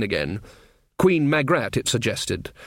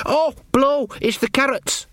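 much higher, sudden yelp of dismay; startled servant; 13 semitones higher than usual. Acted. Servant Sean realising the carrots burn, startled and much higher. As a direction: jump up at the smell of burning.

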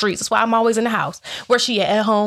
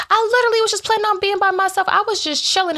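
excited; 11 semitones higher, faster than usual. Real, not acted. Telling how she was single.

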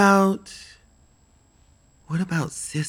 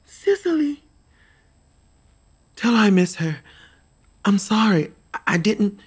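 emotional outburst; 4 semitones higher, 5 dB louder than usual. Acted. Grieving girl reaching dead friend.

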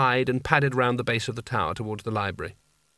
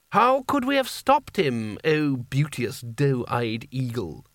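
florid Seraph voice; slower than usual. Acted. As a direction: lay on the flowery flattery like a pampered ruler. Creosote's florid courtly speech.

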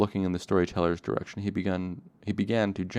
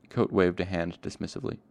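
usual speaking voice

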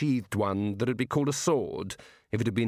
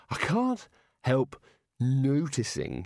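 halting, slow Rincewind; 2 semitones higher, much slower than usual. Acted. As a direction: stretch it out hesitantly. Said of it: "he said".

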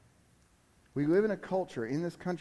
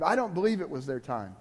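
usual speaking voice

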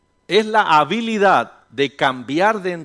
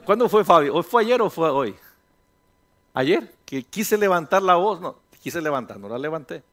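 ordinary delivery

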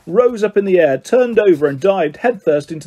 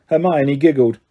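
ordinary delivery, nothing marked

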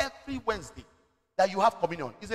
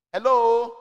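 calling out; 8 dB louder than usual. Real, not acted. Preacher checking the crowd follows.

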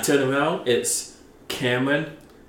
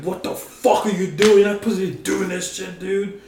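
high, fast mock-angry rant; hammed-up high angry father; furious dad; 9 semitones higher than usual. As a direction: play the pissed dad, pitched way up. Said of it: "He's like".

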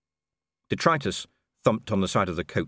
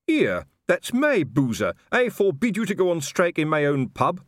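loud, high indignation; indignant; 9 semitones higher, 4 dB louder than usual. Acted. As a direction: protest his pub. Colon indignant.

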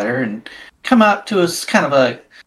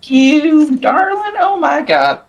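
slow, high, gushing diva; theatrical gushing; 7 semitones higher, slower than usual. Acted. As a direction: drawl it like a luvvie.

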